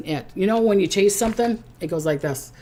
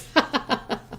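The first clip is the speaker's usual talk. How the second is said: breathy laugh; 7 semitones higher, 5 dB quieter than usual. Real, not acted. Laughing at crab joke.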